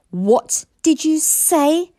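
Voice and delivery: high, loud, slow menacing hiss; hissing dragon. Acted. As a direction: hiss it like an angry she-dragon. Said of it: "hissed Fireworm".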